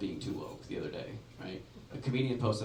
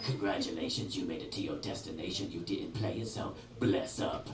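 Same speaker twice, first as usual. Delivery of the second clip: [name] voice; 7 semitones lower, 6 dB quieter than usual. Retold or imitated. GPS as [name] greets arrival, low swagger. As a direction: announce the arrival with lazy swagger. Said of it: "Super cool voice".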